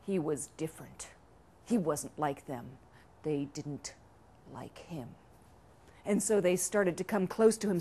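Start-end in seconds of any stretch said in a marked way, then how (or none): 0.0-5.1 hushed narration. A narrator's reading. Narrator hints the chickens dislike the duck, ominous hush. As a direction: drop low and soft, pausing between phrases.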